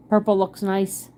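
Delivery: ordinary delivery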